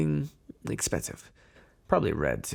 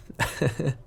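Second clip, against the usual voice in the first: short, light giggle; sweetheart's giggle; 4 dB quieter than usual. Pretend. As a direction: giggle as if smitten. Playing lovey-dovey on a date.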